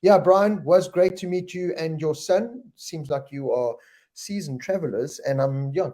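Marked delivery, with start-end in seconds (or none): none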